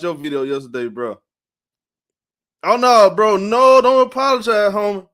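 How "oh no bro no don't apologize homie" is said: louder, higher, eager no, don't apologize; emphatic reassurance. Real, not acted. Streamer telling a viewer not to apologize, loud and emphatic. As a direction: wave off the apology with real energy.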